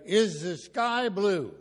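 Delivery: slow, high, loud teacher question; teacher drawing out the question for the kids; teacher asking. Retold or imitated. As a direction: ask it slowly like a teacher to a class. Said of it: "And then she would say".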